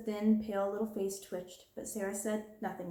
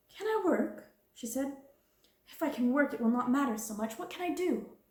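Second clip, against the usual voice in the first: much higher, bright eager questions; girl hearing she may work, hopeful and eager; 5 semitones higher than usual. Acted. Eager hopeful. Sarah finds relief in work.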